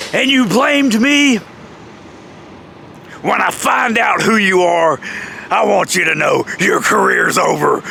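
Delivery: slow, deliberate imitation of an aggrieved boss; mock stern threat. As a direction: spell out the warning, indignant and measured. Jeff's angry meeting speech retold.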